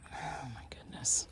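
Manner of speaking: whispered. Real, not acted. Tired sigh in storage unit.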